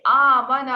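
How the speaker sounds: آمانا with the first a stretched long and loud; long vowel demo. Shown as a demonstration. Teacher shows the student the long آ of آمانا, stretched and loud. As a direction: draw out the opening آ of آمانا. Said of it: "اگر کھڑی زبر اس کو لا".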